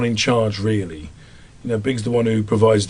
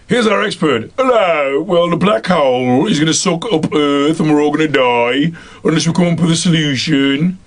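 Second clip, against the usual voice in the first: put-on higher-pitched scientist with a broad Midlands accent; 4 semitones higher than usual. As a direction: play the expert in exaggerated Midlands tones. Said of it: "a scientist or a quantum physicist from the Midlands".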